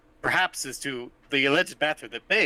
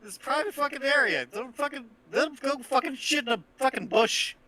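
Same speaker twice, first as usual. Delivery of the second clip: heated argument; 10 semitones higher than usual. Acted. Guard berates the bartender for letting people back, angry and high. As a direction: chew out the bartender.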